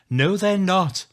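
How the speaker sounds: high loud shout of denial. Acted. Narrator shouts at Rachel down the phone. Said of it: "I'm shouting down the phone now".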